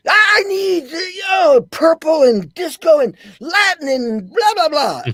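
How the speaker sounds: high, loud hammed-up demand. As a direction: bark orders like a showy director. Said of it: "He'd be like".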